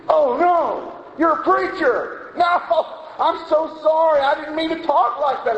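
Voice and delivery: high-pitched, mortified mock panic; imitated horrified apology. Customer apologizing after cussing at a preacher, high mock dismay. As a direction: play a man aghast at his blunder, voice jumping high.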